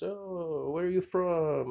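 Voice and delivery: high mock woman's voice; light high-pitched imitation of a woman; imitating the lady. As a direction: lift the voice way up to play her. Voicing the blonde lady.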